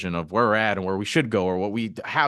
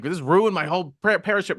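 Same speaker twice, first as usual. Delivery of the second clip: mock angry rant; 8 semitones higher than usual. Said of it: "I'm really pissed off and angry".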